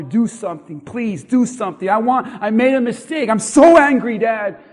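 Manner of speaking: beg, then burst out angry; begging son. Acted. Esau begging his father, building to an angry cry. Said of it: "who begged his father".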